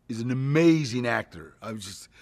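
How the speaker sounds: normal talking voice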